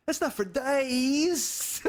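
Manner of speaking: can't hold it together; laughing through the line. Retold or imitated. Imitating Dice, cracking up.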